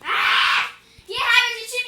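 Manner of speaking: furious yell; angry shout. Real, not acted. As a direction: yell it out in real rage. Furious at friend in game.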